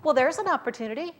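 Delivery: bright, hopeful quote voice; upbeat optimism. Retold or imitated. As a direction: sound like you've spotted a chance. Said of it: "she interpreted it as".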